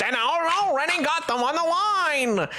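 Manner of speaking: put-on high, slow old-time radio announcer; 1930s radio host. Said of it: "like a nineteen thirties, like, radio host".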